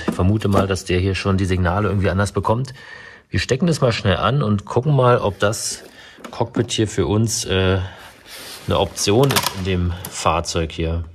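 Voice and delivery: ordinary talk, nothing marked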